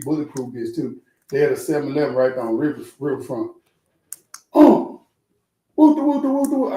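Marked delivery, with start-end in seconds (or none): 4.5-6.7 much higher, louder mock voice of the friend; imitating the caller. Friend calling to brag who he's with, high loud mock voice. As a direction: squeal it out like the excited friend on the phone. Friend's phone call retold.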